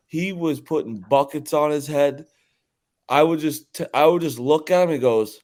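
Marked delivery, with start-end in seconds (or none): none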